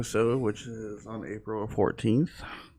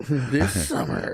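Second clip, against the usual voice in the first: slow, drawn-out trailer announcer; movie trailer voice; much slower than usual. Pretend. Co-host teasing the voice actor, mock movie trailer voice. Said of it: "[name]'s voice acting again".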